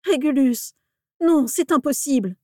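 high, loud horrified protest; horrified cry. A narrator's reading. Father refusing to believe his son is dead, horrified cry. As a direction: cry out in disbelief.

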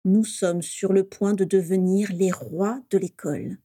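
slow, each word spelled out; slow, deliberate build of suspense; carefully articulated. Acted. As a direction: savour every word for suspense. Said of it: "en articulant soigneusement chaque mot".

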